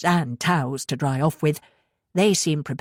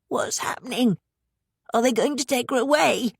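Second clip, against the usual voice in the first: desperate; 7 semitones higher than usual. A narrator's reading. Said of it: "looked desperately".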